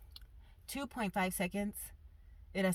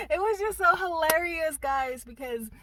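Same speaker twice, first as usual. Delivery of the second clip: laughing; 10 semitones higher, 4 dB louder than usual. Real, not acted. Laughing at the memory.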